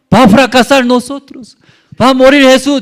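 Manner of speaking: high, loud cry of despair; panicked disciples. Pretend. Frightened disciples crying to Jesus in the storm, high and loud. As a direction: cry out that you are sinking, high and loud.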